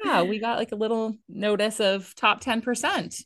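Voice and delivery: usual speaking voice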